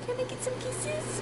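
very high and very soft, almost breathed. Real, not acted. Asking the dog for kisses, tiny high coo.

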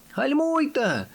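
very high-pitched cry, falling at the end; terrified yell. A narrator's reading. Fenek begging friends to brake before the drop, terrified. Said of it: "нажахано волав Фенек".

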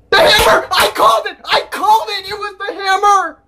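high-pitched triumphant yelling; excited shouting. Real, not acted. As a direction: crow that you were proved right. Prediction confirmed by the trailer.

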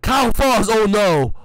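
high, loud startled outburst; alarmed cry. Real, not acted. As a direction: react as your friend hits the floor. Teammate falls on court.

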